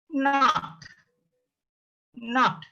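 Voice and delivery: high "Not" with n clearly voiced, long a; sounded N. Shown as a demonstration. Teacher demonstrates N for learners, sounded N. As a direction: hold into "Not" from the n, as naht. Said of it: "It's produced N sound".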